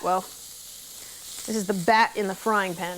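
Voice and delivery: slow sing-song rhyme; Dr Seuss rhyme. Acted. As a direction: read it like a slow children's rhyme. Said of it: "Doctor Seuss rhymer".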